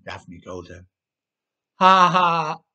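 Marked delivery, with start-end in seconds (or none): 1.8-2.6 loud, higher burst of laughter; laughing. A narrator's reading. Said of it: "began to laugh".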